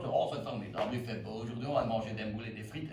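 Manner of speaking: low, mock Marolles Brussels accent; Coluche-style Belgian accent. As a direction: imitate Coluche imitating Brussels. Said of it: "c'est un Français qui imite Coluche".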